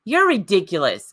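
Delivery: ordinary talk, nothing marked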